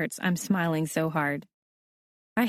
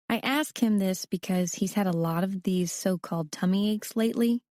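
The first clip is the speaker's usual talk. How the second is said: ordinary delivery, nothing marked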